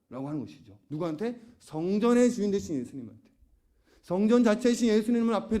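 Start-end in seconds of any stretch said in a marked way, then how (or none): none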